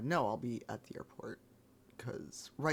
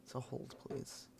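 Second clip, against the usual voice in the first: whispering; 8 dB quieter than usual. Real, not acted. Busy concentrating, asks chat to hold.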